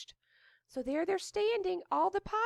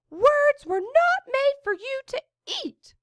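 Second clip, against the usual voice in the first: raised, higher outcry; crying out; 8 semitones higher, 7 dB louder than usual. A narrator's reading. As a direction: cry out in protest. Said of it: "the wizard cried".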